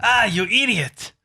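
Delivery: high, drawn-out fake Russian; attempted Russian accent. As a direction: ham up a failed Russian accent, slowly. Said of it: "I don't think it was Russian".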